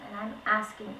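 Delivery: ordinary delivery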